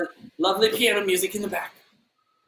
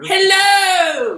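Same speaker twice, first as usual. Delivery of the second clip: high, loud sing-song hello; shouted greeting; 7 dB louder than usual. Acted. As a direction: belt it to the room. Valentina greeting grandly.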